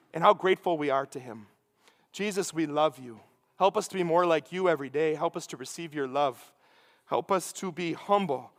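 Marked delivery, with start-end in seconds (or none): 2.1-8.5 soft, low closing prayer. Acted. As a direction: pray it quietly to Jesus, like a humble plea.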